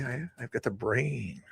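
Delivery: slow, near-whispered aside; stretched-out words, barely voiced; hushed. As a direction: let it out soft and drawn-out. Hushed aside about his brain.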